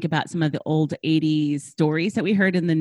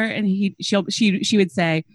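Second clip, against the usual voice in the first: ordinary talk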